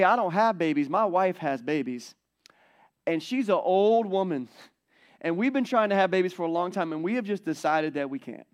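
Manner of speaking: put-on weary old man listing his reasons; Zechariah complaining. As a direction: play a fed-up old priest pleading his case. Pastor voices Zechariah's complaint.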